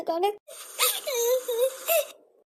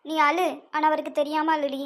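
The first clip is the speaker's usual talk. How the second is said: ordinary delivery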